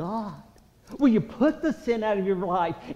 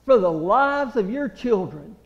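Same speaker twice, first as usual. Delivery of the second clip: loud, ringing altar-call preaching; full-force preaching; 6 semitones higher, 10 dB louder than usual. Acted. Preacher urging the congregation to plead, at full force. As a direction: press the congregation hard to pray.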